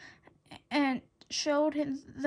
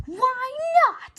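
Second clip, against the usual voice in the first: eager; 10 semitones higher, 7 dB louder than usual. A narrator's reading. Eager agreement.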